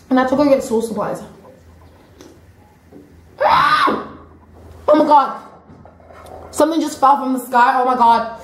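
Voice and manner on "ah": sudden high-pitched shriek; startled scream. Real, not acted. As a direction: shriek as if something just dropped. Startled by something falling.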